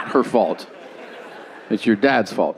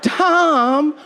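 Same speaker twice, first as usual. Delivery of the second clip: very high, drawn-out mock scolding; dismayed protest; 13 semitones higher, much slower than usual. Dismayed listener scolds Tom for the joke, high slow whine. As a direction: wail it slowly in a high put-on voice. Mimics a dismayed audience member.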